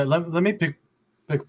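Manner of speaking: normal talking voice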